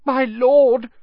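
high, loud gasp; gasped plea. A narrator's reading. Man on the floor to his lord, gasping. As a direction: gasp it out, pitched far up. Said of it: "gasped the man on the floor".

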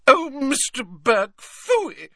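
put-on high, scoffing old lady voice; dismissive old lady. A narrator's reading. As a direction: wave the name away with scorn. Old witch dismissing Burke.

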